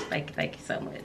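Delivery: soft, voiceless thanks; moved whisper. Real, not acted. Thanking the sender while near tears, moved whisper. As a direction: whisper it, holding back tears.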